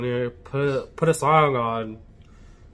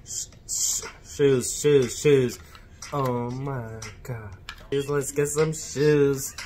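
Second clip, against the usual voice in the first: speech jammer struggle; 5 dB louder than usual. Real, not acted. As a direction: drag the words out, fighting feedback. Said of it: "Speech Jammer app".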